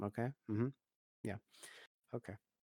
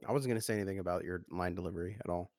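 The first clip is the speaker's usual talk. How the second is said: fast defensive; 3 semitones lower, much faster than usual. Real, not acted. Defensive denial about delivery.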